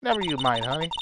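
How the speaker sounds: normal talking voice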